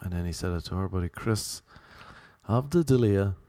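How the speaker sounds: usual speaking voice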